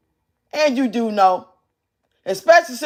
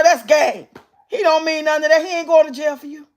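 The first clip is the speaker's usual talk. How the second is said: ordinary talk, nothing marked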